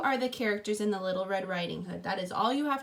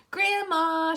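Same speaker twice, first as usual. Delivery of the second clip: a higher, louder call into the house; 7 semitones higher, 7 dB louder than usual. Acted. Girl calling into Grandma's cottage, higher and louder. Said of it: "she called".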